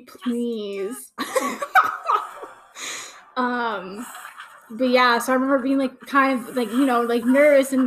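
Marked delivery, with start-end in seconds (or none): 1.2-2.5 squealing high burst of laughter; a delighted shriek of a laugh; laughing at Callum on screen. Real, not acted.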